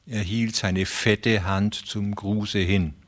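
usual speaking voice

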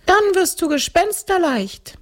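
very high-pitched ghost voice. Acted. Ghost instructs the girl. (hohe Gespensterstimme)

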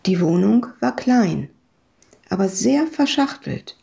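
usual speaking voice

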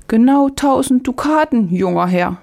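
high-pitched market woman; storybook vegetable woman, pitched well up; vegetable seller. A narrator's reading. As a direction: play the old tale's vegetable seller in a high voice. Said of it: "antwortete die Gemüsefrau".

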